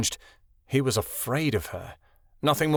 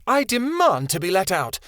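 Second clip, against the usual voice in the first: demanding; 6 semitones higher than usual. A narrator's reading. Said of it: "Chameleon snarled".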